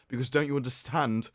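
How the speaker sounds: ordinary talk, nothing marked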